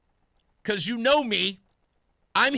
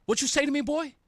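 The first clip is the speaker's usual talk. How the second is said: street challenge, pitched up and pointed; confrontational challenge; 5 semitones higher, faster than usual. Retold or imitated. To Ned Lamont, a confrontational street challenge. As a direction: square up and call him boy. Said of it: "That's what I would have said".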